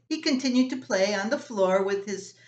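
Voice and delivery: plain reading voice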